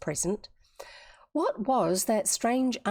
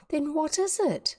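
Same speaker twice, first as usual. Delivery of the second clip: high, insistent question; demanding; 9 semitones higher than usual. A narrator's reading. Tavia questioning her tearful friend, demanding. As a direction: press for an answer, pitch raised. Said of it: "demanded Tavia".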